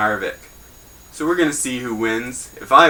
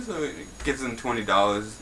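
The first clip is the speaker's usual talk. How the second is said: ordinary talk, nothing marked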